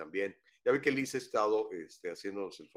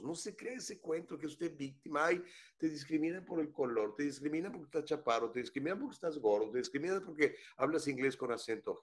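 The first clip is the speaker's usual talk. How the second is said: tag; 6 semitones higher than usual. Acted. Se burla del discurso de víctima.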